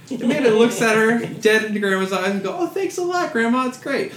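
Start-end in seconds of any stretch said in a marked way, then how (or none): 2.6-4.1 high-pitched imitation of a grateful woman; mock sweet thanks. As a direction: pitch it way up for a gushing thank-you. Amanda thanking grandma, acted.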